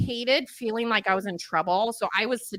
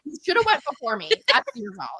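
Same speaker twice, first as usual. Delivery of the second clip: fast; 7 semitones higher than usual. Shown as a demonstration. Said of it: "repeated slowly".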